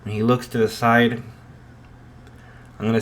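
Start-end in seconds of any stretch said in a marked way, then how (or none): none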